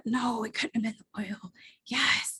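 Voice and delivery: hushed back-and-forth inside her head; whispered self-doubt. Retold or imitated. Debating with herself over the oil, whispered. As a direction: whisper it like a secret argument.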